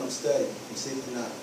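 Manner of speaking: low, soft, half-hearted mumble; weak answer. Shown as a demonstration. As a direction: give in softly to baby. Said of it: "This is what it needs to sound like".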